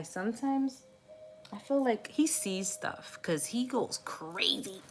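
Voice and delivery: ordinary talk, nothing marked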